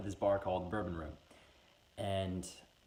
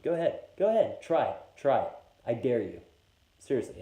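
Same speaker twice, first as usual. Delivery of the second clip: loud, higher jeering dare; taunting whiskey; 3 semitones higher, 7 dB louder than usual. Acted. The Maker's Mark shot taunting the narrator, loud jeering dare. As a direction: goad him like a bully daring a kid.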